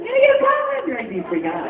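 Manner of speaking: high, brighter sidekick voice, agreeing; high little fighter going along; eager little guy. Pretend. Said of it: "And he'd be like".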